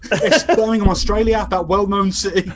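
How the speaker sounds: a hint of an Australian accent, loud; jokey Australian twang; mock Australian accent. Caller on the phone in a jokey Australian accent. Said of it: "with this accent".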